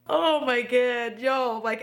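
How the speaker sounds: real tears welling up; tearful. Real, not acted. Telling viewers about losing it at this song, tearful. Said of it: "am crying".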